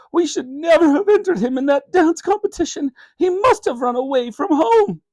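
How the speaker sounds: high, tearful lament; a mother sobbing it out; through tears. Acted. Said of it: "added Mum through her tears".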